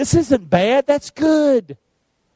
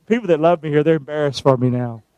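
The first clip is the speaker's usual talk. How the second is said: usual speaking voice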